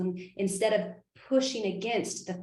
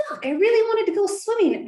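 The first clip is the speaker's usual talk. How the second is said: a much higher, louder mock frustrated cry; frustrated outburst; 12 semitones higher, 7 dB louder than usual. Coach acting out her anger about swim class, frustrated outburst. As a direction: let the disappointment burst out, half-playful.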